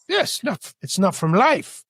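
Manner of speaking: high-pitched, mocking snooty Australian; snooty Australian accent. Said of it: "very snooty Australian accent".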